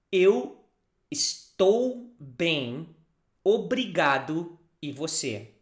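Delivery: Eu estou bem, obrigado, e você drawn out slowly; slow model sentence. Shown as a demonstration. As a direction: say each word slowly for learners. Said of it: "try to practice".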